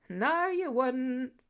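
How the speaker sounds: slow, drawn-out chorus of voices; chiming reply. Acted. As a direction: drag it out in a sing-song group chant. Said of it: "came everyone's chiming reply".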